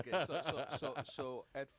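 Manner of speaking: laughing loudly through the words. Real, not acted. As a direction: crack up while trying to go on. Banter about being baby boomers.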